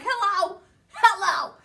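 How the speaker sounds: loud, high insistent hello; calling out. Retold or imitated. Woman checking if the silent caller is still there, calling out. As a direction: call hello to someone gone silent.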